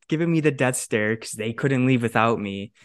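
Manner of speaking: usual speaking voice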